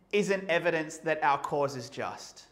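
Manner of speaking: ordinary delivery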